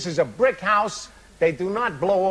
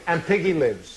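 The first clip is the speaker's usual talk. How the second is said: usual speaking voice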